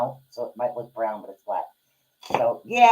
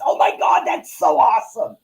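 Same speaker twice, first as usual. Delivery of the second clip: real excitement, pitch jumping up and louder; excited burst; 9 semitones higher, 5 dB louder than usual. Real, not acted. To viewers, gushing over the arthritis mouse, excited. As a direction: gush over the gadget. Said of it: "Sorry, I get really excited sometimes".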